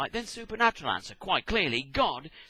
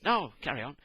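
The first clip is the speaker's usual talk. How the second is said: ordinary talk, nothing marked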